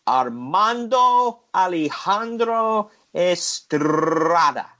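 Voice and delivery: put-on slow, drawn-out Latin-accented name; lightly put-on Latin accent. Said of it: "I don't know if the accent's racist or not".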